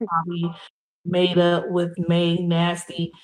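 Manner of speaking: ordinary talk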